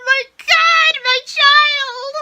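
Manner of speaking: loud, high burst of fan joy; overjoyed cry. Real, not acted. Reviewer cheering her favourite character, loud and high. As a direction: cry out like a proud parent.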